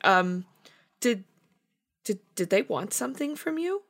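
nervous stammer. Acted. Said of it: "she just stammers for a moment".

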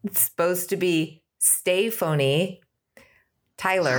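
Stefani said slowly, steh-FAH-nee, Tyler with a long I. Shown as a demonstration. Cohost correcting the way people say her own name, steh-FAH-nee TY-ler. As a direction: stress the middle of Stefani and keep Tyler as TY-ler. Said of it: "people just say Stephanie Taylor".

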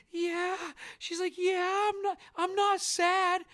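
put-on high little girl voice, a bit slow; daughter's voice. Daughter insisting to her dad, high childlike voice. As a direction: play the tearful child insisting. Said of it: "And she's like".

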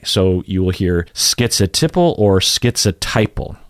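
"schizotypal" first with tip, then with TIE-pul; ti versus tai. Shown as a demonstration. Teacher contrasting the two third syllables. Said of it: "you will hear ti or tai".

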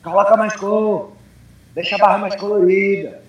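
loud, high hype-man coaching; cheerleader coach. Retold or imitated. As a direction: pump them up like a cheerleader coach. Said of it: "eu chamo de coach cheerleader".

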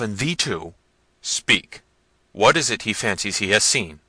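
loud, stern interrogation; exasperated prince. A narrator's reading. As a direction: berate the servants, pressing loudly for sense. Manfred berates the servants.

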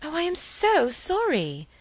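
high, drawn-out apology; crying out an apology. A narrator's reading. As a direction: gush it out, pitched well up. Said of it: "cried Helen".

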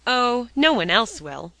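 cheery and emphatic; cheerful. A narrator's reading. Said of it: "declared Polly cheerfully".

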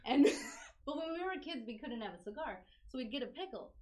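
normal talking voice

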